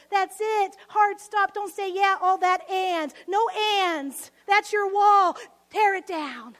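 loud, forceful declarations; emphatic preaching. Real, not acted. Preacher commanding the congregation to tear down walls, loud and forceful. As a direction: hammer each short phrase home.

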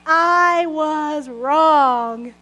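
very slow, halting I was wrong; painfully slow admission; struggling to say it. Shown as a demonstration. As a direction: force the words out reluctantly. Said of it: "I'm still not very good at saying it".

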